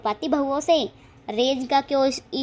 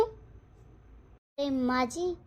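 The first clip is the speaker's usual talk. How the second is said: slow, soft, stretched mocking address; quiet stretched taunt at the threatening mother-in-law; drawn-out defiant call; 8 dB quieter, much slower than usual. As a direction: draw out the words to taunt. Daughter-in-law answers a threat.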